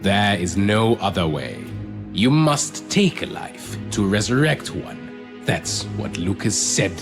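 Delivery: tag. Acted. Villain insists they must kill.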